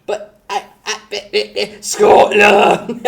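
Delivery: mock bewilderment, dragged out; stunned stammer. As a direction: drag it out in disbelief. Bewildered mock protest ends.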